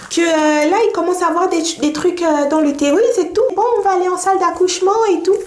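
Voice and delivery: high put-on explaining voice; imitated midwife. Midwife tells patient it's normal and delivery room awaits, high imitation. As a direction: play the midwife, pitched well above your own.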